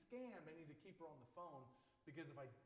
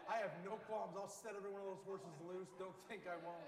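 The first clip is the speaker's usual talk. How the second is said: loud mock-vindictive threat; vindictive outburst; 6 dB louder than usual. Stood-up customer rants about revenge on the seller, loud vindictive threat. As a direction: play up the revenge threat, hitting it hard at first. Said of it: "I got some vindictiveness".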